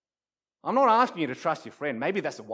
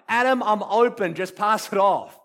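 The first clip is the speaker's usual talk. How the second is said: louder, urgent call for the pass; friend's shout; 5 semitones higher, 7 dB louder than usual. Acted. Friend open on the basketball court calls to Adam, shouting. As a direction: call out loudly to a teammate. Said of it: "I hear my friend shout".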